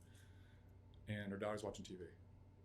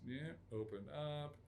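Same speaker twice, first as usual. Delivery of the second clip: ordinary talk, nothing marked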